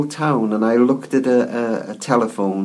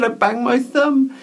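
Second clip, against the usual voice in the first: a lightly voiced small boy, higher and slow; boy tells the operator about his thumb, small high voice; little boy; 10 semitones higher than usual. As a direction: play the hurt little boy on the phone, slowly. Little boy hurt, phoning operator.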